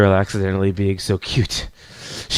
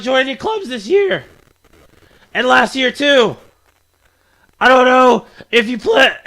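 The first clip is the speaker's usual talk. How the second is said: put-on higher-pitched, scolding anime girl; raised-pitch indignant schoolgirl; feisty club girl; 5 dB louder than usual. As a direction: complain about the newcomer in a girlish character voice. Voices a game character.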